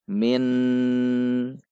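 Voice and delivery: "min" dengan n jelas dan ditahan panjang; bukan ikhfa. Shown as a demonstration. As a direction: tahan bunyi n pada "min", contoh salah. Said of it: "bukan dibaca". (nun "min" terlalu jelas)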